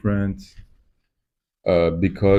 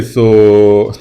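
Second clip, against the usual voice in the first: ordinary talk, nothing marked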